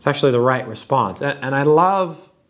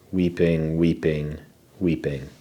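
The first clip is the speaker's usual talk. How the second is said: fading repetition; 10 semitones lower, 5 dB quieter than usual. Acted. Repeating the word, dwelling on grief.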